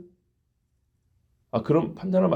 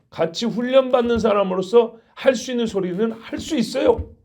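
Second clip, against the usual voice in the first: normal talking voice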